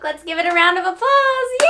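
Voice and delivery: high, loud cheer; rally the class to clap. Real, not acted.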